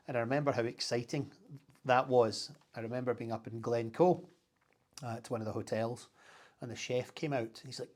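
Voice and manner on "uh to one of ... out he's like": normal talking voice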